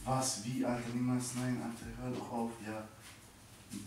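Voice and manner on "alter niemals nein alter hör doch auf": ordinary talk, nothing marked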